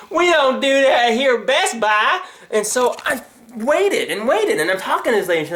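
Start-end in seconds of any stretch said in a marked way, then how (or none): 0.1-2.2 high, drawn-out mock employee; slow, high, mocking refusal; clueless store staff. Staff refuse a puzzled customer, high slow clueless voice. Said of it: "that thought isn't going through their head".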